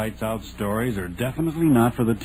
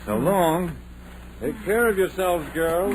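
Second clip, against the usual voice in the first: calling out; 10 semitones higher than usual. Acted. Driver calls farewell.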